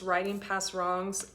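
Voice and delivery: ordinary delivery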